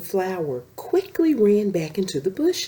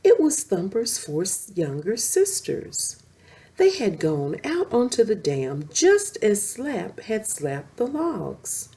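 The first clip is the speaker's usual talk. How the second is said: ordinary delivery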